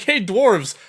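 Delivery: ordinary talk, nothing marked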